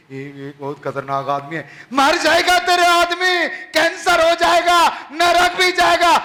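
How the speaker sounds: high frightened retelling; alarmed wife. Retold or imitated. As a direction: she panics about the danger.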